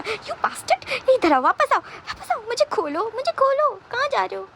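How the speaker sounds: high, strained calling after someone leaving; desperate captive's cries, held back in volume; acted distant yelling, pitch way up; yelling from background. A narrator's reading. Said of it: "Vibhushan background में चिल्ला रहा था".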